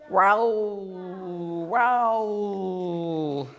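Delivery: drawn-out growl; deep growl. Shown as a demonstration. A teacher imitating a pickerel frog growl. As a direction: growl it slow while floating. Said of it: "growls deeply".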